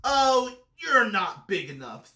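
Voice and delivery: loud dismissal, high drawn "Oh"; grouchy bug backing out with bluster; grouchy ladybug. A narrator's reading. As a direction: brush them off loudly. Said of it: "said the grouchy ladybug".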